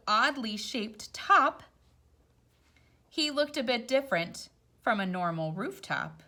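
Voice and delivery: gentle storybook read-aloud; rhyming story voice; reading to children. A narrator's reading. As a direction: read it like a picture book at bedtime. Reads picture book aloud.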